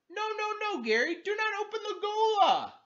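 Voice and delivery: very high, loud frantic yell; yelled warning. Acted. Gilbert yelling at Gary not to open the gola, frantic high shout. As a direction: shout to stop him before it's too late. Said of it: "Gilbert Gass yelled".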